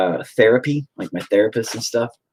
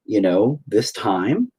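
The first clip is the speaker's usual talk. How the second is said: usual speaking voice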